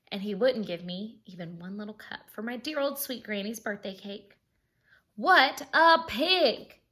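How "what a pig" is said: slow, loud indignant exclamation. Acted. Wolf sums up the rude pig, slow and indignant. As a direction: drag it out in outrage. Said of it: "Talk about impolite".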